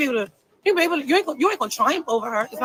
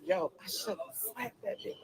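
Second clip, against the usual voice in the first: much softer, a low mutter under her breath; muttering to herself; 6 semitones lower, 15 dB quieter than usual. Retold or imitated. Alone at home after the fight, muttering regret. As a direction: grumble it to yourself, barely aloud. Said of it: "I was in the house like".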